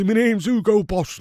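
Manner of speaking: a hint of a put-on pompous voice; mock voice. Streamer jokes as the lookalike manager, lightly put-on voice. Mocking the manager's look-alike.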